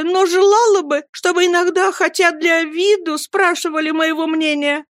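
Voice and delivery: high, tearful pleading; young wife begging, close to crying, pitched high throughout; holding back tears. A narrator's reading. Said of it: "глотая слёзы, проговорила она". (высоким голосом, умоляя сквозь слёзы)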